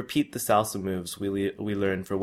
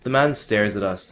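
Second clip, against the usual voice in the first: ordinary delivery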